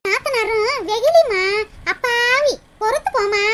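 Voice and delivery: slow, sugary praise for the listener behind; butter it up slowly, play innocent; sudden sweet talk. Acted. Friend suddenly praising the sister-in-law she sees standing behind, in slow sweet tones. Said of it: "மாத்தி மாத்தி பேசிக்கிட்டு இருக்க".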